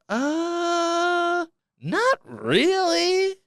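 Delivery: high, very slow, drawn-out denial; sarcastic falsetto. Acted. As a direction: sing out a coy no.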